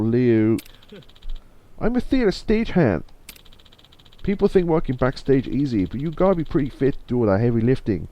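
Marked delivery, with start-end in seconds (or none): 1.8-8.1 put-on Chinese accent, starting high and settling. Theater stagehand explains the job, put-on Chinese accent. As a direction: do a throat-straining mock Chinese accent. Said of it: "I'm not gonna do that accent too much".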